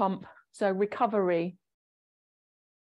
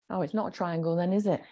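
ordinary talk

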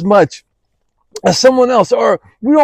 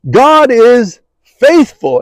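God is faithful, slow with a firm fall; slow weighty statement; 3 semitones higher, 3 dB louder, slower than usual. Shown as a demonstration. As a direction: land each word deliberately. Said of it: "It would be enough to simply say".

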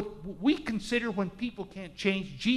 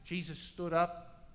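ordinary talk, nothing marked

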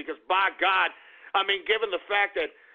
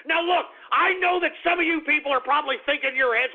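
normal talking voice

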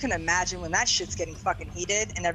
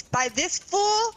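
mock outrage; 13 semitones higher, much slower than usual. Real, not acted. Outraged at losing to a player.

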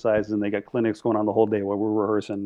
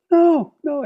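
very high, louder imitated dismay; mock alarm; 5 dB louder than usual. Drummer watching his director in trouble, mock alarm. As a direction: squeal it like a panicking onlooker.

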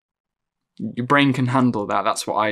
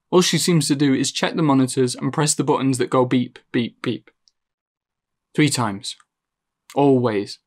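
ordinary delivery

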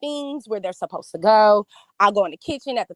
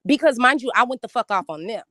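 ordinary delivery

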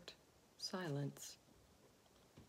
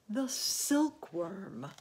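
excited cry; 3 semitones higher, 6 dB louder, much slower than usual. A narrator's reading. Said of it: "cried the old green grasshopper".

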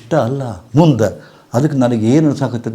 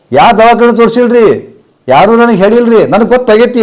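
high, louder woman's voice, insistent; imitated fearful woman; 10 semitones higher, 6 dB louder than usual. Guru voicing the woman who fears cancer: high, insistent plea. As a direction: play the panicked disciple pleading. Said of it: "ಅಂತಂದ್ರು".

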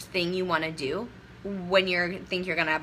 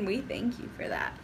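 breathy whisper after a laugh; whispered thanks; 5 dB quieter than usual. Real, not acted. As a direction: whisper it like a giggling aside.

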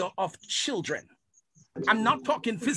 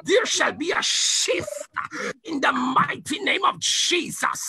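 fiery preaching; 2 semitones lower than usual. Acted. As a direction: preach it with full force. Preaching at full force.

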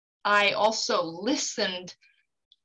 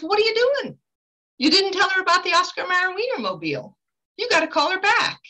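much higher, scolding voice of a spirit; indignant spirit; 11 semitones higher, 3 dB louder than usual. Retold or imitated. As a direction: imitate a pushy spirit demanding action. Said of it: "he shows up and he says".

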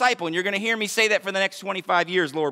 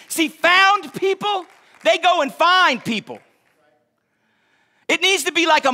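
preacher's high cry; 8 semitones higher, 4 dB louder than usual. Real, not acted. Preacher building to a peak.

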